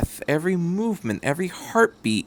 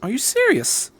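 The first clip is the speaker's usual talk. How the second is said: higher, startled question; shocked; 12 semitones higher than usual. Acted. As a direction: jolt up in disbelief. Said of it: "The doctor sounded shocked".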